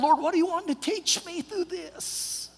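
high-pitched mock lament; exasperated plea. Frustrated traveler asking God why, high plea. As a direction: send it up high, pleading.

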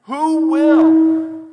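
high, very loud drawn-out yell; defiant scream. Retold or imitated. David screaming back at fear in the face, defiant shout. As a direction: scream it back at fear, holding the last word. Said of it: "screams back at it".

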